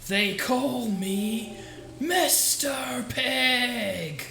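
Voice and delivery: very slow, drawn-out proclamation; hammed-up, long-held vowels; grandiose mock announcement. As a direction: stretch every word out theatrically, pitch lifted. Performs a mock catchphrase line.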